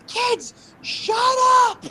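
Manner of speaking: high-pitched loud mom yell, imitated; pissed-off mom. Mom yelling at kids from upstairs, imitated angry shriek. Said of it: "get pissed off upstairs".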